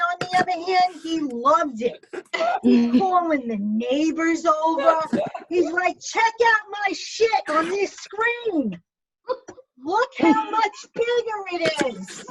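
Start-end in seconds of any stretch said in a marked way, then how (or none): 6.0-12.2 higher, slow boastful husband voice; hammed-up bragging husband; proud husband. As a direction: show off to the neighbors.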